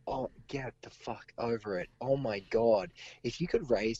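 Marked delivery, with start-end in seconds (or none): 0.1-1.9 softer, held-back rant; quiet rant. Real, not acted. Ranting to the co-host, voice too sore to shout, quiet but fed up. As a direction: grumble it under the breath, still biting. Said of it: "I'm just gonna say this quietly".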